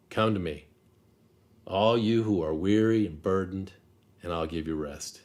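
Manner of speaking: soft, unhurried scripture reading; read slowly. Shown as a demonstration. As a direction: let each phrase sink in, gently and quietly. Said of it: "I'll read them slowly".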